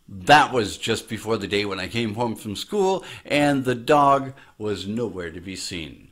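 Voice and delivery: ordinary delivery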